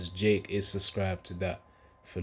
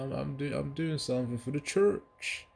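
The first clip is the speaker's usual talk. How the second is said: put-on higher voice sliding into a Nigerian-sounding accent; accidental Nigerian-sounding accent; 7 semitones higher than usual. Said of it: "Don't know why that accent".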